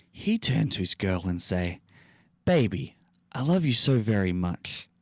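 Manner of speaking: milk every broken sentence for laughs; dramatic story reading. Acted. Streamer reads a badly typed horror story to chat, hammed up.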